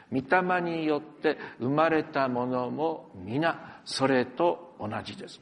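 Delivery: slow, measured reading aloud; reading scripture slowly to the congregation. Shown as a demonstration. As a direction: lead the congregation through the verse slowly. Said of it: "一緒に読みましょう".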